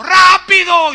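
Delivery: high, very loud full-out yell; desperate shout. Retold or imitated. Desperate man a metre from the top, desperate shout. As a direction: scream it out desperately, mouth wide open. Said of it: "gritó y dijo".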